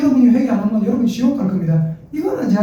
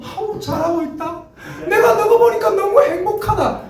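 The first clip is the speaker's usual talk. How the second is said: very high, delighted praise; delighted God; 10 semitones higher than usual. Pretend. God looking at repentant people, very high delighted praise. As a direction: beam with joy as a pleased father.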